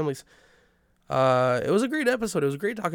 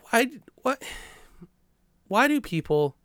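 exasperated; 8 semitones higher than usual. Real, not acted. Frustrated rant about airport line.

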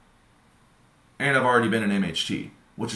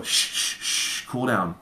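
mock shushing; slower than usual. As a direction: soothe a boiling kettle with a whispered hush. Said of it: "You can't go".

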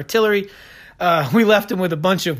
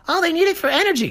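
sarcastic, much higher sing-song mimicry of an official excuse; mocking quote; 11 semitones higher, faster than usual. Podcaster mocking Albright's North Korea excuse, high sarcastic mimicry. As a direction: parody the diplomat's line in a high, prissy mock voice.

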